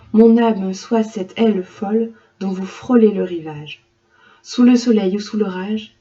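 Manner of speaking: loud, sweeping poetic reading; full-voiced recital of a poem; poem recitation. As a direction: declaim it to the class.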